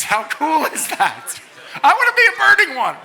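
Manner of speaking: high, loud gleeful laugh through the words; giddy with delight at the idea; excited laughing. Real, not acted. Preacher to students, thrilled by the burning ones, laughing loudly.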